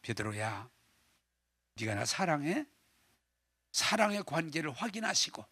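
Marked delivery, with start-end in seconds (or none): 0.0-2.6 soft tender question. Acted. Jesus asks gently after mock scolding.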